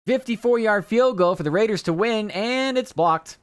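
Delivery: high, loud play-by-play call; announcer call. Retold or imitated. Recapping the blocked kick, loud high announcer call.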